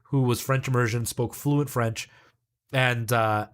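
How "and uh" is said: usual speaking voice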